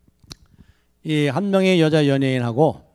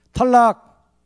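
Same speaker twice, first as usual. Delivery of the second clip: sharp, higher, louder "탈락!"; rejecting shout; 8 semitones higher, 5 dB louder, much slower than usual. Retold or imitated. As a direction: call it out like a game-show judge. Actress rejects answer sharply.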